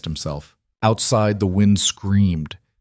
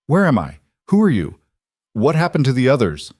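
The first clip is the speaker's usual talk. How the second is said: frightened questions; 5 semitones higher than usual. A narrator's reading. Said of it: "The words tumbled out".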